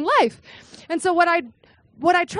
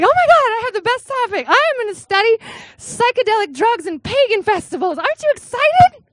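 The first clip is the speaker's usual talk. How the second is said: much higher and louder, bursting with glee; over-the-top excitement; 9 semitones higher, 4 dB louder than usual. Retold or imitated. Student pitching drug research to her advisor, bursting with excitement. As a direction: pitch it like a kid showing off a prize. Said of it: "she was not as excited as I hoped".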